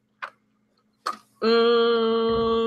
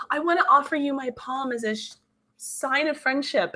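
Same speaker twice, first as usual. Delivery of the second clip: higher, soft imitation of an earnest artist; mock earnest student; 5 semitones higher, 5 dB quieter than usual. Imitating students presenting their thesis, mock earnest. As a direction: float it up softly, slowing at the end.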